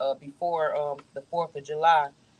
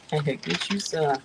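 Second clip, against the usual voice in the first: slow, stretched out, teasing; slow drawn-out offer; much slower than usual. Shown as a demonstration. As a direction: draw every word out slowly. Offering food, repeated slowly.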